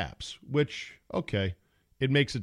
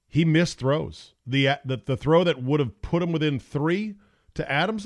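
ordinary talk

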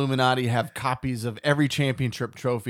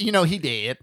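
[name] impression; 4 semitones higher, 4 dB louder than usual. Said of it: "[name] walks by like".

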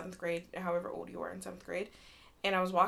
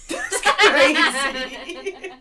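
high, loud laugh through the words; laughing disbelief; 7 semitones higher, 14 dB louder, much slower than usual. Real, not acted. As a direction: crack up while saying it. Laughing at own trauma joke.

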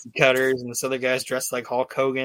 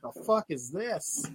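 baffled wrestler; 6 semitones higher, 6 dB quieter than usual. Retold or imitated. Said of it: "he's just looking around like".

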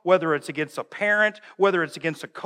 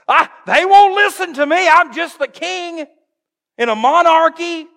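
loud, high-pitched excuse-making; mock helpless protest; 11 semitones higher, 8 dB louder than usual. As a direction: plead helplessness in a raised, hammed-up voice. Mocking Saul's excuse as helpless king.

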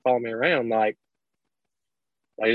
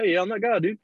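higher laid-back reply; lightly pitched-up chill self; mock casual self; 7 semitones higher, faster than usual. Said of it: "I'm like".

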